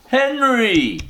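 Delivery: high, loud, shocked "Henry!"; shocked cry. Acted. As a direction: catch her husband in the act. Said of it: "cried Missus Brown".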